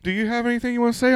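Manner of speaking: imitating bosses, high and quick; high fast mock boss. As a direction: squeak it out like the boss. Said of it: "they're like".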